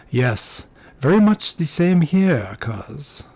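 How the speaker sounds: put-on drawn-out, lilting young Frenchman; French accent. A narrator's reading. Cousin returns the feeling, slow French accent. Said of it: "in a heavy French accent".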